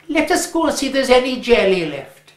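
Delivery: lightly voiced eager boy, quick. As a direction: rush it, already thinking of jelly. Jack moving on to jelly.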